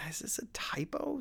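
high, breathless whisper to himself; wondering about the odd name, whispered; puzzled whisper. Retold or imitated. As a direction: whisper it like a private double take.

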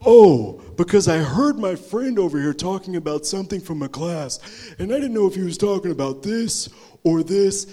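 loud, high-pitched know-it-all imitation; mock condescending. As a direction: show him up like a smug student. Bully showing off to embarrass rival.

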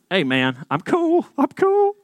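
high, squeaky hurried reassurance; backing off fast, voice jumping up high; nervous placating. Retold or imitated. Small boy squeaks up at the angry bully on the bus.

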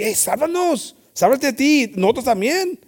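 high, pushy plea from the cross; mocking thief. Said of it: "Uno ahí".

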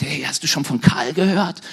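lightly voiced rumour-monger; a hint of someone spreading rumours; gossiping acquaintance. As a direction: pass on damning gossip as if confiding. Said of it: "kommt irgendjemand zu dir und sagt".

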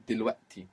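"دلوقتي" with a Cockney-style throat catch for ق; glottal stop. Shown as a demonstration. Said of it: "It's exactly the same sound".

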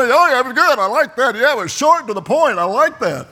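high, loud, hammed-up approval; mock delighted praise. Preacher imagining praise for a short sermon, exaggerated delight.

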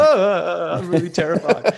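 high, loud, laughing mock fright; hammed-up terror laughed through; mock terrified. Young actor mocking his fear of the titans, laughing mock terror. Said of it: "You're like".

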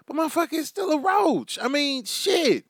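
high disbelieving cry; incredulous outburst. Acted. Disbelief at organic roaches.

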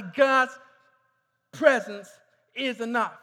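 bold, assured call-out; with confidence. Shown as a demonstration. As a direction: say it like you know it's true. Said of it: "say it with some confidence, with some boldness, with some assurance".

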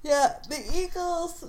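talking through a yawn. Real, not acted. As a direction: stretch the words in a yawn. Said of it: "yawny today".

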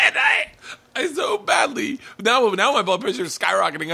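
high, squeaky laughing speech; helpless real laughter pushing the voice up; cracking up. Real, not acted. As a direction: struggle to get the words out through laughter. Cracking up while telling the joke.

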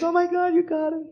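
high, moved mentor voice, a touch of tears; a hint of weepy joy in a high voice; crying with joy. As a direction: mentor cries at the news in a high voice. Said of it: "He starts crying".

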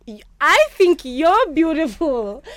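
slow, high and loud, swooning; flustered and giddy. Retold or imitated. Telling the host how she answered the compliment, slow and swooning. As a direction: gush it out slowly, starry-eyed. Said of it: "And I was like".

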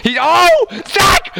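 sudden high call; excited shout. Acted. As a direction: burst out at the play.

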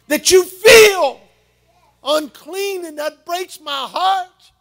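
loud, high, slow, heartbroken cry; breaking voice. Real, not acted. Grandfather crying out over his granddaughter's shame. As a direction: let the heartbreak crack through.

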